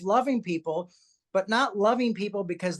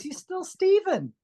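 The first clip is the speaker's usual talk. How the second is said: high, slow, tender mother's voice; mom's gentle reply; 13 semitones higher, slower than usual. Retold or imitated. Mom reassures her son, high and slow. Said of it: "She goes".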